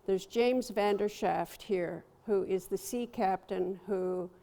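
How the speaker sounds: ordinary delivery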